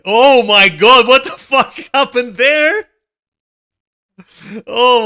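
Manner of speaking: loud, slow, rising oh my god; shocked outburst. Real, not acted. Streamer sees the raid wiped, loud shocked outburst. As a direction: shout it in disbelief.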